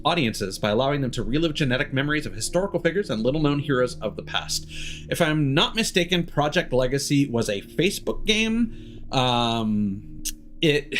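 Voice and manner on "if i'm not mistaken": usual speaking voice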